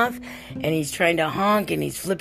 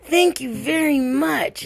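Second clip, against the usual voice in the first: put-on grateful young woman; 8 semitones higher, slower than usual. Stranger's voice in retold story.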